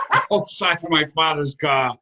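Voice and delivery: ordinary talk, nothing marked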